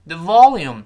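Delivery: loud "volume", "The" passed over quickly; "The VOLUME" with a strong first syllable; stress on volume. Shown as a demonstration. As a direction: swallow "The" and hit "volume". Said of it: "volume要重读".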